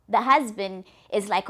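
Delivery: ordinary delivery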